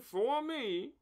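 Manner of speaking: usual speaking voice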